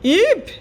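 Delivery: a big ogre yelping in fright; frightened yelp. Acted. Ogre hears of the dragon, yelps loud in fear. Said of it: "yelps the ogre in horror and fear".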